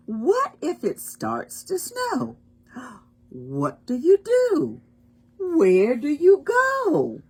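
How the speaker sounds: high, animated storybook questions; dramatic wondering. Acted. Reader asking children the book's refrain, dramatic wondering. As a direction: pitch the questions up with a gasp of suspense.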